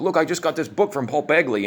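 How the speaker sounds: mock excited boast. [name] showing off his new book, mock excited.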